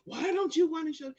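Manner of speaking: high, loud demanding question; guard yelling at the husband over the receipt; angry guard yell. Retold or imitated. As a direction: throw it at him like an accusation.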